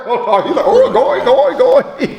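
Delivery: high, giggling mock toy voice; Tickle Me Elmo. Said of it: "sound like Tickle Me Elmo".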